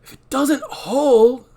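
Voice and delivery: high, slow, anxious; fearful and drawn out; Dan voice. Acted. Dan voiced in table read.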